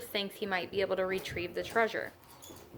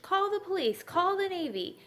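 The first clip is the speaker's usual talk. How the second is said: shrieking; 10 semitones higher than usual. A narrator's reading. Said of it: "shrieked the coach".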